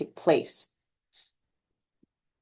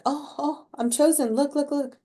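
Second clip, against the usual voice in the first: put-on excited inner child; 8 semitones higher than usual. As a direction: pipe up like a kid who just got picked. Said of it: "your inner child feeling like".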